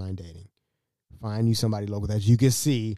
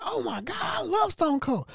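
excited. Retold or imitated. Dad gushing to guest about a wrestler, excited. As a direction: gush in a squeaky put-on voice. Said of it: "he got excited".

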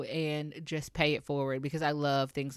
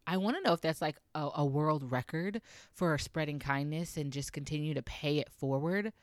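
ordinary talk, nothing marked

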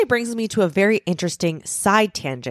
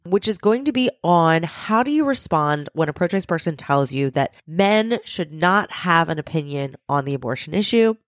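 ordinary talk

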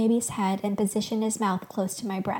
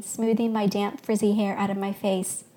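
ordinary delivery

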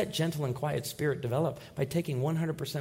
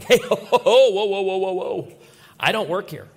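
loud, laughing, flustered whoa-whoa; laughing protest; 5 semitones higher, 9 dB louder than usual. Retold or imitated. Preacher reenacting being mistaken for a waiter, loud and laughing. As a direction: fend him off through laughter.

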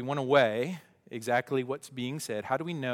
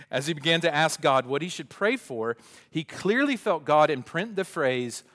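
louder, higher story reading; reading aloud; 3 semitones higher, 8 dB louder than usual. Acted. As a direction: read it out, building to the quote. Reads book passage aloud.

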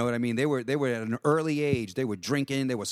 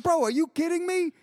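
much higher, louder baffled question; retold incredulity; 4 dB louder than usual. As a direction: challenge a friend in disbelief. Retold disbelief at friend's boasts.

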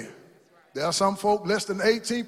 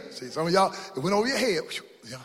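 ordinary talk, nothing marked

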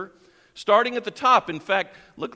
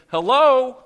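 a sudden loud, higher "Hello." to grab attention; 6 semitones higher, 10 dB louder than usual. Pretend. Jesus calling loudly to disciples who aren't listening, loud hello. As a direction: call out "Hello." like snapping fingers at sleepy students. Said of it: "If it were today, he'd be saying".

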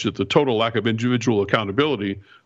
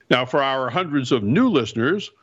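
ordinary delivery

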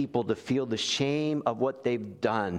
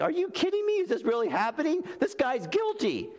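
much higher, incredulous outburst; disbelief; 14 semitones higher than usual. Acted. Official to bishop, incredulous and much higher. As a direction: play the stunned official, pitch leaping up. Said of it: "the official's like".

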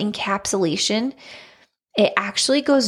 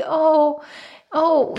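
lightly voiced, higher husband's "oh"; retold sympathetic husband; 7 semitones higher than usual. Husband reacts to wife wanting a baby, retold sympathy. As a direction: let out higher, mock-tender ohs. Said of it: "he's like".

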